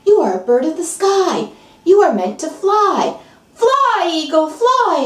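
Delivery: rising, ringing urge to fly; rousing command. Acted. Friend urges the eagle to fly, rising excited call. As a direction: cheer the bird skyward.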